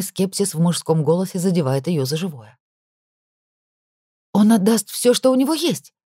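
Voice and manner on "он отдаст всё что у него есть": high, louder, heated promise; fervent assurance. A narrator's reading. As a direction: insist with heat. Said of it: "горячо заверяет Кая". (горячо, с жаром)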